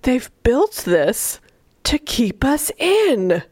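slow, drawn-out mock horror; acted appalled. Retelling his dawning realization about class, slow appalled disbelief. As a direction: stretch each phrase out as if aghast. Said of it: "He's appalled at everything".

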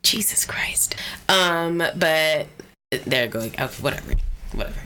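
whispered exclamation. Real, not acted. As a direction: mutter it in a whisper. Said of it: "talk a little softer".

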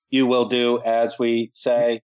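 slow, measured robot command; word by word, like an obedient machine's master; mock robotic authority. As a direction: play a rule-giver droning out orders. Mimics robotic rule-givers after robot remark.

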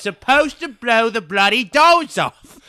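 high, loud mock Cockney shout; hammed-up outraged British film star; Michael Caine. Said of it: "Your one accent is Michael Caine".

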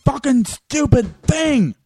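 slow, loud, high furious swearing; angry cursing. Real, not acted. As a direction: drag it out, fed up and furious. Cursing broken equipment.

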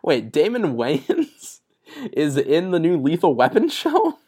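drawn-out, amused disbelief, pitch leaping on the last word; slow, astonished question with a laugh breaking in; incredulous. Real, not acted. Podcast host stunned by the casting news, incredulous.

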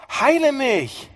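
hoher, lauter Hilferuf; flehender Ruf. Acted. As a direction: ruf verzweifelt um Heilung. Prediger spielt Bittende. (hoher, flehender Ruf)

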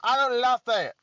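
a hammed-up swaggering character taking over.

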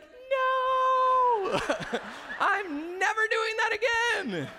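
put-on very high, slow, laughing kid voice; shaken little boy. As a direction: squeak it out like a rattled little boy who survived. Son shaken after the slide.

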